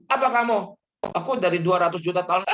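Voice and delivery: loud, fast indignant retort; wife hits back at her husband, loud and fast; wife snapping back. Acted. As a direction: fire back at the husband, offended.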